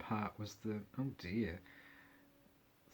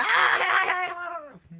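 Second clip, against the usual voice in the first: high shriek; 8 dB louder than usual. Retold or imitated. Mock [name] shriek.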